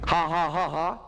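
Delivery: low, forced ha-ha; mock laugh, each ha spoken; fake joking laugh. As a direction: laugh drily at your own jab. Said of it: "I'll make the jokes".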